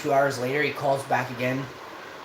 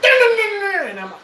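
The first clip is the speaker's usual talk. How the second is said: high drawn-out mock wail of the slot machine; imitated jackpot siren; 7 dB louder, much slower than usual. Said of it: "it makes it sound like".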